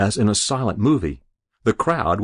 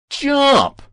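high, loud single cry; shout; 6 dB louder than usual. Acted. Lone young voice in the crowd shouting up to the roof, loud high cry. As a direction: fling the word up at the roof.

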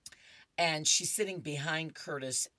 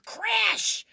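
mock crash; 9 dB louder than usual. Comic sound effect read out.

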